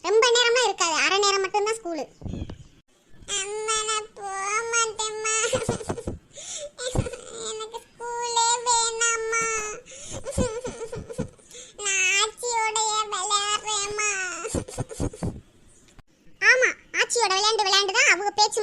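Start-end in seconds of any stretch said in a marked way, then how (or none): 3.4-14.1 put-on slow, whimpering child's plea; tearful begging to mother, acted; crying child. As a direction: drag out each word between little sobs. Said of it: "அழுது அழுது மூஞ்சி பூரா".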